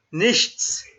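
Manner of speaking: Nichts with clear final ts; teacher models Nichts for repeating; crisp Nichts, ending on ts; model pronunciation. Shown as a demonstration. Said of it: "You need to read it like this".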